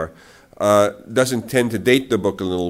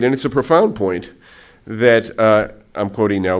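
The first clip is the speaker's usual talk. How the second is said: normal talking voice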